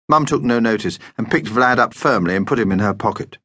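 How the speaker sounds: ordinary delivery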